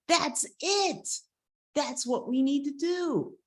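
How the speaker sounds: high, eager eagle voice; excited discovery. Retold or imitated. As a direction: burst out with a sudden bright idea. Eagle hits on an idea.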